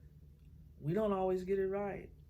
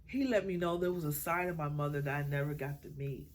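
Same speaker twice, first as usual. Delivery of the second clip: ordinary delivery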